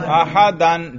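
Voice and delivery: "احدن" slowly, ending in a clear -dan; نصب کا تلفظ. Shown as a demonstration. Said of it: "نصب پہ تلفظ کرو". ("احدن" کے آخر میں دو زبر، یعنی اَحَدَن)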